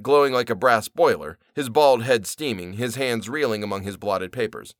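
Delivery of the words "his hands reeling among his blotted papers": plain reading voice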